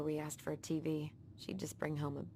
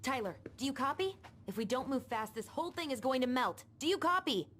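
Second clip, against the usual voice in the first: toy walkie talkie; 7 semitones higher, 4 dB louder than usual. Pretend. Kids playing walkie talkie game.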